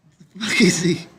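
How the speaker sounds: high, incredulous swear; can't believe what he's seeing; sharp rise of disbelief; baffled exclamation. Real, not acted. Shocked reaction to video.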